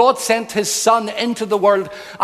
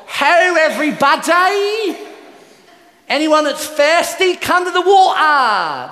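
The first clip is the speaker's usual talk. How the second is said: high, loud, drawn-out Cockney market cry; hammed-up Cockney costermonger call, pitched way up; Cockney barrow boy; 8 semitones higher, 4 dB louder, slower than usual. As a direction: holler to the crowd like a bad Cockney street trader. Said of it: "like a cockney standing at a market".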